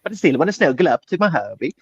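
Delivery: put-on Swedish accent, lilting up and down; sing-song mock Swedish accent; weird Swedish accent. Snuggling up to my hubby, in a weird Swedish accent. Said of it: "weird Swedish accent I've been doing lately".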